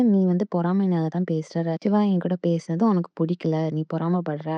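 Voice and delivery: ordinary talk, nothing marked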